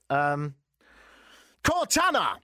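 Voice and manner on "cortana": high, loud topic announcement; announcer call. Acted. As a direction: call out the name like a reveal.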